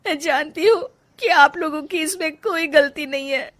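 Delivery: tearful, slow, voice breaking with sobs; wife weeping to husband and mother-in-law; crying. Acted. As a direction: spill out broken dreams through tears. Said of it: "Rajni रोने लगती है".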